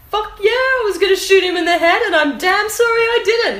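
high, loud defiant retort; unapologetic. Retold or imitated. Guest retelling a singer's interview about the gun, unapologetic. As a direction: act out her brazen, loud admission. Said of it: "unapologetic and good on her".

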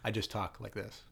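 soft, inflectionless deadpan; quietly monotone, as if incapable of emphasis; David Duchovny. Retold or imitated. Mocking an actor who refuses to whisper for the script, deadpan. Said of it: "everything is deadpan".

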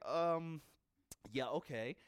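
hesitant, with a pause after um; reluctant agreement. Acted. Ananias answers God's order, reluctantly agreeing. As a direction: give in half-heartedly, dropping at the end.

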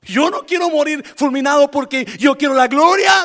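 loud high quote of Saul; Saul's fearful words. Retold or imitated. Preacher voices Saul refusing glory. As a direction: speak as a Saul afraid of God. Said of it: "decía Saulo".

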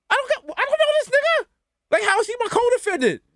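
very high-pitched comic protest; hammed-up panic, voice way up; baffled outrage. Protesting a stranger named as co-defendant, incredulous.

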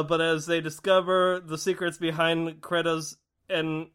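usual speaking voice

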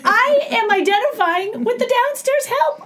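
high-pitched comic indignation at oneself; mock dismay. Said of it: "I was like".

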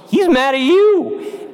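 a high, slow, singsong warning; lightly mock the kids by drawing it out; teasing teacher voice. Teacher warning the students about the angry priest, high and drawn out. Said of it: "She's like".

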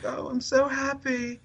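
put-on high, slow British boy voice, blissfully pleased; happy British accent. Playing the British fan pleased with his life, happy British accent. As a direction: imitate a self-delighted British lad. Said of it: "constantly pleased with himself".

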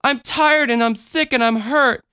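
put-on upset child's mild yell; shouting child. A narrator's reading. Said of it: "she shouted".

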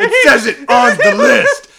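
hammed-up shriek, high and loud; psychopath dad. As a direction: insist on the list as a mock-crazed dad. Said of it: "Just a psychopath dad".

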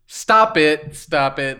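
loud protesting shout. Acted. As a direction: cry out to make it stop. Knox protesting in story.

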